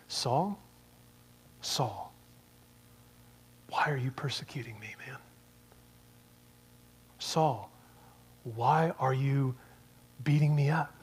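soft, hushed pleading voice; quiet, tender plea, half whispered; gentle quiet Jesus. Acted. Jesus gently asking Saul why he persecutes him, hushed. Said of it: "the compassion in Jesus' voice".

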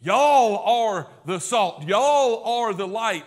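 slow, loud, "Y'all" hit hard and falling; emphatic y'all. Shown as a demonstration. Preacher driving home the plural to the congregation, emphatic. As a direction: punch "Y'all" each time, slowly. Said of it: "And it's emphatic".

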